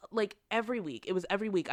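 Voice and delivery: ordinary talk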